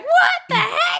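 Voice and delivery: very high, loud, slow outcry with laughter; mock shocked shriek. Imitating reaction to the stabbed rice bag, mock shock. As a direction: squeal it in hammed-up disbelief.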